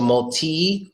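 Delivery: "multi" slowly as mul-TEE; TEE ending. Shown as a demonstration. Teacher contrasts endings, multi with TEE. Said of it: "three ways to say that word".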